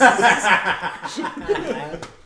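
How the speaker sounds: high loud burst of laughter. Real, not acted. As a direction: break into a big laugh. Laughing at own joke.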